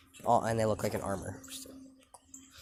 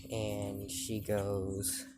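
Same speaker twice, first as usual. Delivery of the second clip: ordinary delivery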